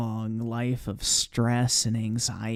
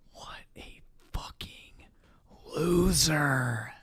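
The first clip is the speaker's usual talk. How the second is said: unvoiced disgusted whisper; slower than usual. Real, not acted. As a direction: hiss it under your breath. Disgusted aside after donation.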